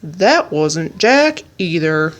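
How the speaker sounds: slow, low disappointed giant voice; giant muttering. A narrator's reading. Otto the giant mutters to himself, let down. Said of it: "he muttered to himself".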